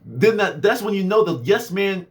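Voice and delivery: normal talking voice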